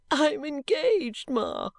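high, drawn-out tearful wail; sobbing. A narrator's reading. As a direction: plead slowly as if through sobs. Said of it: "sobbed Caddy".

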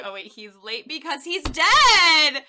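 loud, slow shocked discovery; sudden realization. Retold or imitated. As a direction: realize it all at once.

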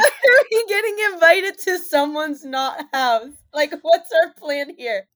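loud, laughing incredulous question; laughing disbelief. Real, not acted.

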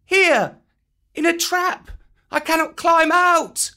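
put-on very high boy's voice, loud; Mowgli crying out. A narrator's reading. Mowgli, trapped, crying up to his friends. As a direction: call up from the trap like a child. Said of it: "cried Mowgli".

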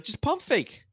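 imitating. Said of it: "Eddie said it on the broadcast".

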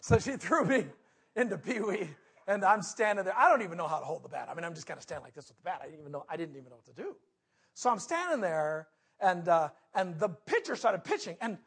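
ordinary delivery

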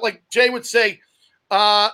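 usual speaking voice

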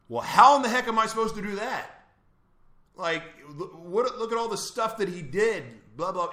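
high, incredulous retort; protesting. Retold or imitated. Retold prisoner arguing back to God about forgiving, high protest.

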